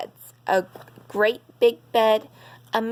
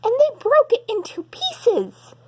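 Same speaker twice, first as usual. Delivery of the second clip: put-on very high, louder, fast baby bear cry; cried; 13 semitones higher, 4 dB louder than usual. Baby Bear finds his chair, very high fast upset cry. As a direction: wail it out like an upset little cub. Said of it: "he cried".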